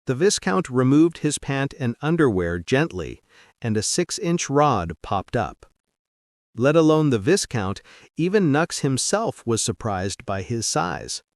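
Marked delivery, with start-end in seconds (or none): none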